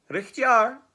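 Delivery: high, loud mock-agreeing gruffalo; fit to burst. Gruffalo humouring the boastful mouse, loud bursting amusement. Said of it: "fit to burst".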